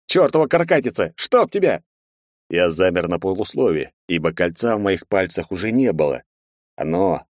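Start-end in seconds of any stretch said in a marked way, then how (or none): 0.1-1.8 громко и всё выше, в ярости; проклинай уплывающего кракена; злой крик. Acted. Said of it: "пробулькал я". (яростный крик)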